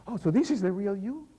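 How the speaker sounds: loud, delighted exclamation; light up with joy; overjoyed. Acted. Gawain overjoyed at true form.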